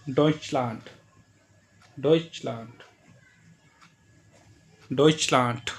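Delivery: Deutschland with the final d said as a hard ट; German final d as t. Shown as a demonstration. Teacher showing German final d in Deutschland. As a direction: end Deutschland on a crisp ट sound. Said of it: "टा की sound निकालेगा".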